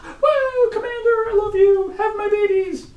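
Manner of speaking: extremely high-pitched cheering; mock fangirl squeal. Fan in the crowd adoring the commander, mock squeal.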